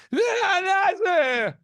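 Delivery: high, loud stumbling announcement; flustered announcer. Retold or imitated. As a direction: blurt it out as the nerves hit. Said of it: "it'd be like".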